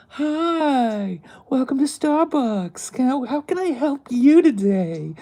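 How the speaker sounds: high, loud, sugary customer-service voice; over-the-top smiley chirp at the register; fake-sweet coworker greeting a customer, very high; fake nice barista. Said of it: "over the top, smiley".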